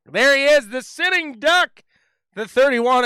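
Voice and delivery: loud, high playful hail; excited announcer call. Real, not acted. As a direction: call it out big, stretching the words. Host hails the sitting duck.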